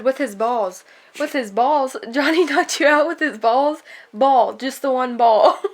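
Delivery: loud, lively back-and-forth of a misunderstanding; mock incredulous. Reader acts out Shannon's mix-up with Johnny's mom, incredulous. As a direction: act it out with rising disbelief. Acting out heated book dialogue about balls.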